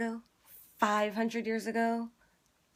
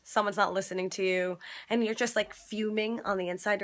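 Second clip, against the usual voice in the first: ordinary talk, nothing marked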